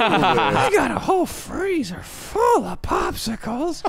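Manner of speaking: put-on high, slow creepy old man; drawn-out creepy neighbor voice. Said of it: "The guy on Family Guy".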